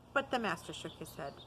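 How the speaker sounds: ordinary delivery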